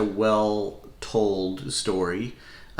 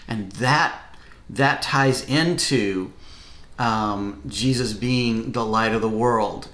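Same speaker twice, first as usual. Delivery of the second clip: usual speaking voice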